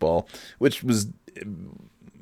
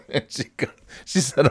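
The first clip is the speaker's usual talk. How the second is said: usual speaking voice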